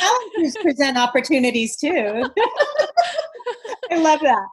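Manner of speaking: loud, high laughing delight. Real, not acted. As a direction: gush with laughter.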